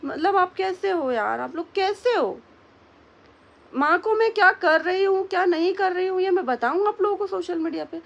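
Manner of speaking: high-pitched indignant outburst at the viewers; outraged. Real, not acted. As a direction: demand of them how they can be like this. Venting anger at commenters.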